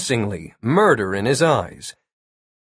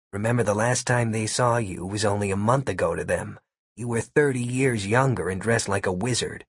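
lightly voiced lower sidekick; a touch of lower, wry friend; Gary's voice; 3 semitones lower, 6 dB quieter than usual. A narrator's reading. Gary's voice in the story.

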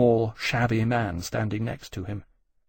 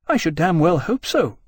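louder, higher, scathing retort; indignant retort; 6 semitones higher, 5 dB louder than usual. Acted. Jane standing up to challenge the admirals, indignant. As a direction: throw it back indignantly. Jane angrily rising to object.

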